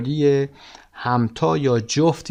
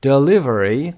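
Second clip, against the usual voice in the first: Delivery with LI punched louder; stress on LI; 5 dB louder, slower than usual. Shown as a demonstration. Teacher shows the stressed syllable, de-LI-very punched. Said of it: "قسمت لی".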